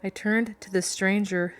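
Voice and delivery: ordinary delivery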